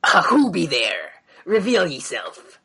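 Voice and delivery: slow gruff pirate captain. Acted. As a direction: growl it like an old sea dog. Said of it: "Should I do a pirate voice".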